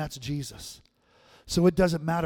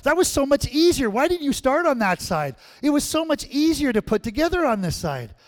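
put-on much higher, louder triumphant little girl; excited child; 11 semitones higher, 9 dB louder than usual. Granddaughter showing grandma the finished puzzle, thrilled high shout. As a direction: burst out proud like a kid who won.

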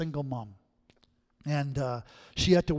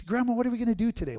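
lightly voiced eager child, higher and fast; little girl; 8 semitones higher, faster than usual. Granddaughter asking grandma about the day, eager little voice. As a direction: chirp it quick like a small kid.

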